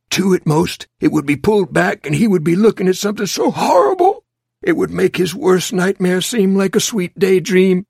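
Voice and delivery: tense narration pitched high and pressing; mounting dread. A narrator's reading. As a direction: build the horror to a fast, loud peak. Narrator builds to horror climax.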